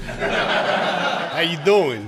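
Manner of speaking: hammed-up pickup line, stretched slowly; playfully suggestive, milk it for laughs; mock flirting. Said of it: "a little more innocent than that".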